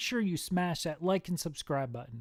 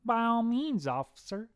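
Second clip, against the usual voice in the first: higher, lilting welcome; obliging farmer; 8 semitones higher than usual. Acted. Farmer answers agent, higher obliging voice. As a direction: wave the officer on breezily.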